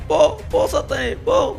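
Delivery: high yelled go go; panicked high yell to run; frantic yell. Real, not acted. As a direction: shout him onward in panic. Chased, yelling at character to go.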